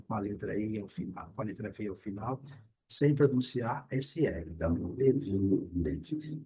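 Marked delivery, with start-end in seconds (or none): 4.9-6.4 "live" with a very short i, like lev. Shown as a demonstration. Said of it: "Esse I com som bem curtinho". ("live" com i bem curtinho)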